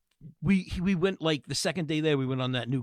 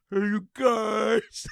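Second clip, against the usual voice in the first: drawn-out, higher-pitched mock holler; retold yell; 5 semitones higher, much slower than usual. Retelling what was yelled at the big guy, mock yell. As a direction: call it out long and high, not full force. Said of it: "I yelled".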